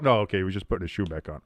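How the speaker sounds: ordinary talk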